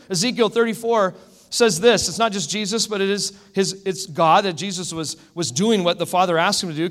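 ordinary talk, nothing marked

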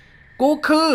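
loud, slow spirit voice snapping back; gruff snarl. A narrator's reading. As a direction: bark it as the angry ghost. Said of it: "เสียงห้าวใหญ่ตะคอก".

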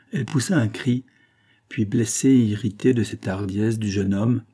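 usual speaking voice

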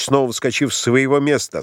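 usual speaking voice

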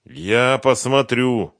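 very slow, loud, lifted insistence; stubborn. A narrator's reading. Сухарев struggling up to check the money, stubborn. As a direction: stretch it out, unbudging. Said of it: "упрямо сказал Сухарев".

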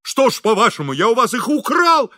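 high, loud indignation; boiling over. A narrator's reading. Engineer accused over his own chairs, boiling over. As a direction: demand it in rising outrage. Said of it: "вскипел инженер".

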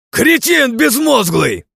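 high, loud furious bark; angry bark. A narrator's reading. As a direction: bark it out in rage. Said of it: "рявкнул разозлённо я".

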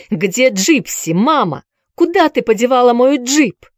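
shouting, rising in panic; desperate shout. Acted. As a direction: cry out for the lost sister. Он снова закричал. (отчаянный крик)

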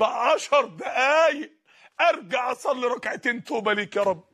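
anguished shout from the grave; desperate plea. Acted. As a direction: drag out the words, pleading for one last chance. Dead man begs from the grave.

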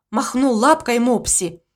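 ordinary delivery

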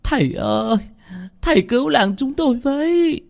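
very high, pleading wail; whimpering, desperate plea; tearful wailing. A narrator's reading. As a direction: beg for help on the edge of tears. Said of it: "bà Hằng Nước mếu máo".

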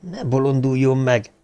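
usual speaking voice

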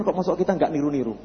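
ordinary talk

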